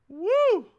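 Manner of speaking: high, loud whoop of joy; whooping cheer after the fan's kindness; joyful woo. Real, not acted. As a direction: shout it out to release the feeling. Cheering after emotional moment.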